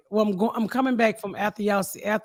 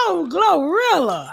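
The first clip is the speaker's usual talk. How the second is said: slow, adoring gush of her name; 9 semitones higher, slower than usual. Retold or imitated. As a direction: stretch the name out lovingly. Gushing over [name].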